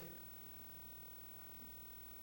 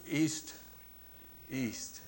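laughing; 7 semitones higher, 4 dB louder than usual. Real, not acted. Laughing at own mix-up.

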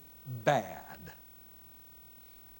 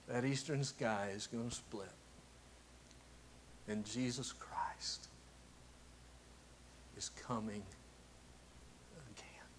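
hushed sermon climax; 11 dB quieter than usual. Acted. Sermon climax dropping to whisper.

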